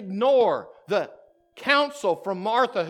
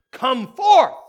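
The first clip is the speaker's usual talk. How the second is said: big ringing shout, much louder; loud cry; 4 semitones higher, 10 dB louder than usual. Acted. Jesus calling Lazarus out of the grave, loud cry. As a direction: call him out of the tomb. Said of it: "He cries out with a loud voice".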